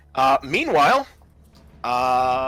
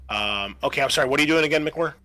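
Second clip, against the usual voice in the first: normal talking voice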